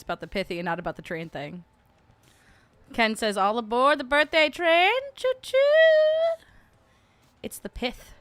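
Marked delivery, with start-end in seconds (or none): 3.4-4.9 loud, high calling-out; train conductor call. Acted. Reads message as train conductor's call. 5.1-6.4 loud, very high choo-choo; train whistle imitation. Imitates train whistle after boarding call.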